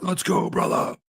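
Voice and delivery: high, airy hype; mock wrestler taunt. Host provoking Hulk Hogan, mock wrestler cry. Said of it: "And I was like".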